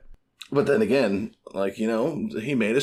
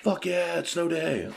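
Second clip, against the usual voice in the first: mock-thrilled kid, higher pitched; excited kid; 7 semitones higher, slower than usual. Kids cheering a snow day, excited. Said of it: "kids are like".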